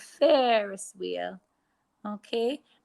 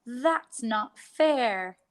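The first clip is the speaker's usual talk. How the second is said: "That's not fair." slow, stretched words; slow modelled sentence; 3 semitones higher, much slower than usual. Shown as a demonstration. Teacher's turn before pupils repeat, slow. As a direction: say it slowly for them to copy. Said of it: "My turn".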